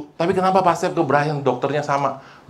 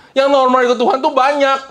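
high, forceful sermon voice; raised, ringing declaration; impassioned preaching; 12 semitones higher, 5 dB louder than usual. Real, not acted. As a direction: drive the point home to the congregation.

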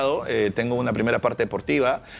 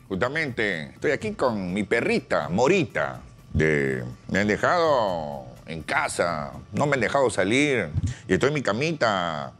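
Vizcarra impression; 6 semitones lower than usual. Impressionist voicing Vizcarra at home, low cosy politician voice. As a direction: coo the diminutives, pitched low. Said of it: "yo le imito a Vizcarra".

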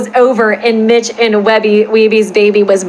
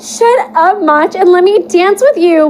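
put-on high, whiny girlish plea; high-pitched whiny fanfic heroine; whiny Weeby; 7 semitones higher than usual. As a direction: whine it like a clingy girlfriend. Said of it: "whined Weeby".

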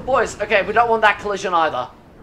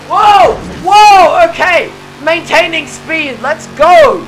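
high, loud whoas building into a cheer; excited shouting; 10 semitones higher, 12 dB louder, much slower than usual. Real, not acted. Gamer maintaining speed through traffic, excited shouting. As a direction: shout it out, pitched way up. Thrilled at keeping speed in game.

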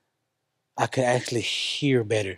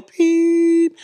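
high loud held beep like ears ringing; imitated ringing beep; 14 semitones higher, 7 dB louder than usual. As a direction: hold a long shrill beep. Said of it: "it go".

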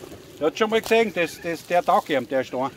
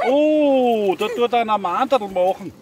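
überrascht; 6 semitones higher, 5 dB louder, slower than usual. Real, not acted. RC car crawls over rock.

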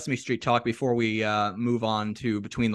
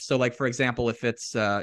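usual speaking voice

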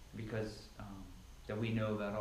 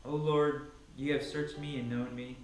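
rising, impassioned psalm reading, louder and higher as it goes; fervent Bible reading swelling into preaching; scripture reading; 4 semitones higher, 3 dB louder, slower than usual. Acted. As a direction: proclaim the psalm like a preacher building fervor. Reads Psalm 139 aloud.

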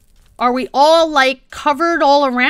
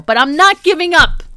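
ordinary talk

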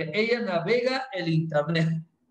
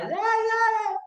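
high, hammed-up child's screech; mock screaming; 11 semitones higher, slower than usual. A crying girl screeches in class, high and hammed-up. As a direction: screech it like a tantrum.

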